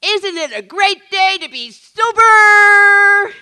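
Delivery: high, loud, slow rallying cry; imitating Paul's cheer. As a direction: boom it out like an upbeat old-timer greeting the room. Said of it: "he'd say".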